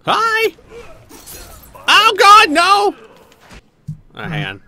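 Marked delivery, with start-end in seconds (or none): 0.0-0.5 very high squealed hello; yelled greeting. Real, not acted. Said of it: "Why are you yelling". 1.9-3.0 high, loud panicky shriek; panicked scream. Real, not acted. Panic during the fight.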